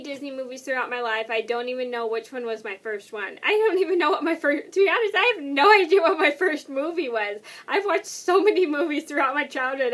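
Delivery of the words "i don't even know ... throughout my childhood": louder, higher, emphatic protest; flustered insistence. Real, not acted. Vlogger stumped by her first Disney movie, flustered. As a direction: insist loudly that you're stumped.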